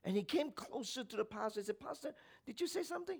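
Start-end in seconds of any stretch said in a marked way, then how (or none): 1.8-3.2 high, soft, quick question; hushed, puzzled high voice; straining to hear. Acted. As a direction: ask gently, leaning in close. Man leans in to hear mumbler.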